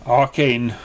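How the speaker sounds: plain reading voice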